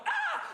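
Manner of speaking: sudden high, loud shriek; mock scream. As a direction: imitate a wife's startled scream. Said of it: "his wife would scream".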